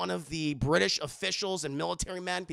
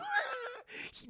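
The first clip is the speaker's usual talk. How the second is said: high laugh; 7 dB quieter than usual. Real, not acted. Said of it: "That's an amazing impression".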